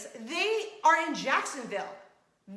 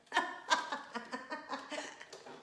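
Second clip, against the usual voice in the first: quiet, breathless giggling fit; real wheezy laughing; helpless laughter; 3 semitones higher, 8 dB quieter than usual. Real, not acted. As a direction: crack up at your own confession. Cracking up at her confession.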